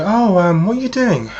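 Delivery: high, lilting mock-female chat-up; flirty woman striking up conversation. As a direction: voice a woman casually approaching a stranger, pitched well up. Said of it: "She might be saying to you".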